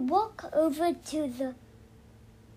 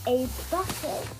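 put-on reading to a toddler. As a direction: lull a little one to sleep. Child guiding a sleep story.

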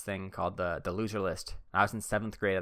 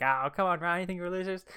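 lightly high playful protest; a fake hurt friend, pitched up; mock friend whine; 10 semitones higher than usual. Friend teasing Ryan about the list, mock whine. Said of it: "And then, like".

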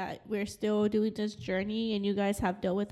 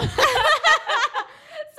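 excited high laugh; 8 dB louder than usual. Real, not acted. Excited laugh at fan story.

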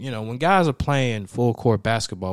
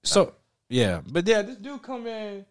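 ordinary talk

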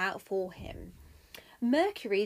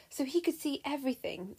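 ordinary delivery, nothing marked

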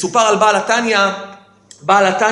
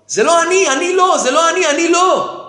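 high-pitched imitation of protesting outcry; retold cry of protest; 7 semitones higher than usual. Accused man denying it to his attackers, retold cry of protest. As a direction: plead innocence higher, as if crying out. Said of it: "ומה הוא התחיל לצעוק?".

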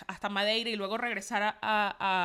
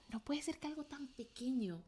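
hushed amazement; 10 dB quieter than usual. Retold or imitated. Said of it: "Y yo decía".

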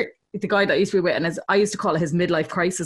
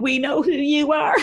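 slow, raised teasing call; tipsy mock call-out; 5 semitones higher than usual. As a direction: call it out slowly, a few drinks in. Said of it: "we're like".

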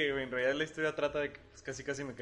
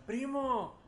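high, mock yell of a name; a pitched-up mock shout for his cousin; calling out; 11 semitones higher than usual. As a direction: call across the crowd. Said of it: "en eso gritan".

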